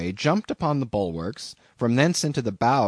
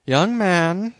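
raised, stern form of address; 7 semitones higher, 4 dB louder than usual. A narrator's reading. Pious captain rebuking the narrator, mildly stern. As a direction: pull the young man up short. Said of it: "said Bildad sternly".

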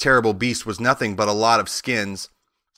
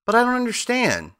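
put-on high-pitched puzzled girl; bewildered; 12 semitones higher than usual. A narrator's reading. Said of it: "said Dorothy in bewilderment".

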